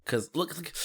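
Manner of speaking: ordinary talk, nothing marked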